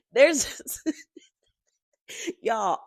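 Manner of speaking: words broken up by laughter; laughing in disbelief. Real, not acted. Telling viewers about her absurd hair day, laughing helplessly. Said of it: "all I can do is laugh".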